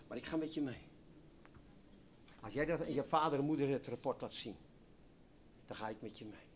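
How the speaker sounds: soft, reassuring voice of God; God speaking softly. Acted. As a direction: promise it gently, hushed. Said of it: "En God zegt". (zacht en geruststellend als God)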